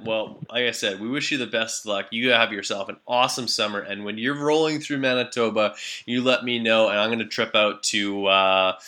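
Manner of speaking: usual speaking voice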